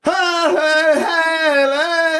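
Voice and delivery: high, loud mock-ecstatic cries; imitated speaking in tongues. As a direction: cry out like a convert seized by tongues. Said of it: "what they are saying in tongues".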